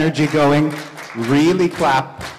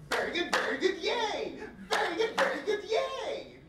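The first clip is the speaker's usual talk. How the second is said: put-on much higher, softer childlike very good, yay; childlike cheer; 12 dB quieter than usual. Shown as a demonstration. Instructor demonstrating childlike playfulness, high squeaky cheer. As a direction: sound like a delighted little kid. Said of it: "I'm going to demonstrate it".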